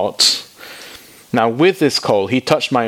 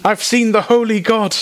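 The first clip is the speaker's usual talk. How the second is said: high, strained panic; a terrified man who knows he's doomed; high comic panic at seeing God; panicked Isaiah; 11 semitones higher than usual. Acted.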